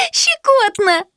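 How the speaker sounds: put-on high, giggly little girl; giggling. A narrator's reading. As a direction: giggle through the word. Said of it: "хихикнула девочка".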